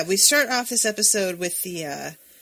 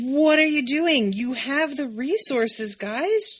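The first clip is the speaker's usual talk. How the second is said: high, slow pleading; talking to the producers, slow high exasperated plea; 7 semitones higher, slower than usual. Retold or imitated. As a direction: beg them to just cast it, drawn out. Mock pleading with filmmakers.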